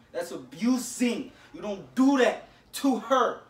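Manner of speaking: slow, loud, deliberate reprimand; angry big brother scolding slowly; stern slow scolding. Real, not acted. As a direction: lay down the law word by word. Lecturing brother for slapping sister.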